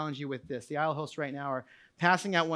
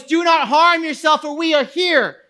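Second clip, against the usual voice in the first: high, forceful preacher's shout; urgent raised-voice command; loud cry; 11 semitones higher, 14 dB louder than usual. Acted. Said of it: "cried out with a loud voice".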